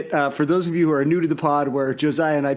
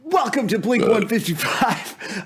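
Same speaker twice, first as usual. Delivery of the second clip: slow, higher announcer greeting; podcast show intro; 5 semitones higher, slower than usual. Acted. Host opening the show for a big guest, slow announcer intro. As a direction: draw out the show's name like a host's opening. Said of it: "do a real one this time".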